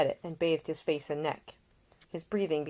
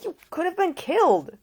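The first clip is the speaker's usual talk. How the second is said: much higher, louder frantic man; voice risen high; 14 semitones higher, 9 dB louder than usual. Acted. Nick fears for Ida, voice leaping high. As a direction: let the voice jump up in panic. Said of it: "His normally low voice had risen nearly a full octave".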